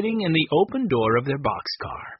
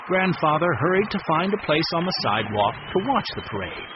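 ordinary delivery, nothing marked

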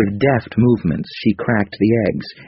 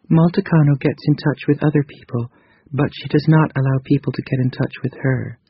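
higher, gentle female character voice; 7 semitones higher than usual. A narrator's reading. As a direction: voice Krita Kano lightly higher. Narrator voices Krita Kano.

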